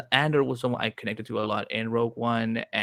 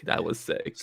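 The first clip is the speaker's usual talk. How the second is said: "That was sick" said again under the breath, nearly voiceless; a quiet, awed afterthought; breathy hushed repeat; 2 semitones lower, 6 dB quieter than usual. Real, not acted. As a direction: echo the words softly to yourself. Repeats the reaction to herself.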